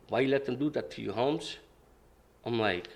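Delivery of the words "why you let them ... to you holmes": straight-faced. Retold or imitated. Gang leader questioning the bullied kid, straight-faced. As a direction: ask it quietly, giving nothing away. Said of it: "looks at me with straight face".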